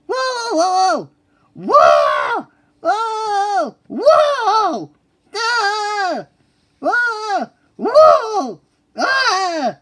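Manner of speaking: high, loud frantic yelps; panicked whoas. Acted. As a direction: scream while losing control. Boat careening out of control.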